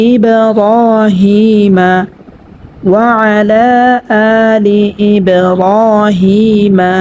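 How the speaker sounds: slow chanted Arabic recitation; Quranic recitation. Shown as a demonstration. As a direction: chant each word slowly with drawn vowels. (ধীরে তেলাওয়াতের সুরে পড়া)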